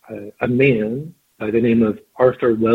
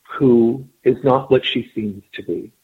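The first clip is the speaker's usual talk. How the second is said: usual speaking voice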